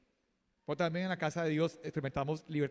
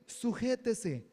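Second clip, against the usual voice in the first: slow, drawn-out sujétese, each syllable weighted; sujétese dragged out slowly for emphasis; emphatic command; 3 semitones higher, much slower than usual. Acted. Repeated sermon refrain.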